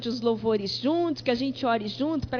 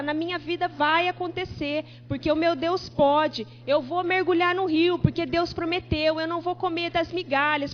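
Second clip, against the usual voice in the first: bold declaration; 3 semitones higher, 4 dB louder than usual. Acted. Declares faith over her life.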